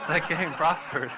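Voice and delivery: loud, slow, laughing through the words; laughing punchline. Real, not acted. Youth pastor cracking up at his own gaming joke, laughing loudly. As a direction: burst out laughing as you land it.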